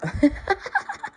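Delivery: gleeful high-pitched giggle; bubbly gloating laugh. Real, not acted. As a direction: giggle at their failure. Laughing at enemies' downfall.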